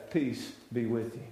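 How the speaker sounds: Jesus comforting frightened disciples, soft and low; hushed blessing. Retold or imitated. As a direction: breathe it out gently.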